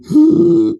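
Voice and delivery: loud, high wheezing gasps; mock wheezing. Runner waiting at the gate, exaggerated wheezing. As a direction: suck in air noisily, badly out of breath. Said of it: "like, wheezing".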